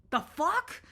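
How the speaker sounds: comic double-take at a dark thought; startled. Pretend. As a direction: caught off guard by your own mind. Startled by own intrusive thought.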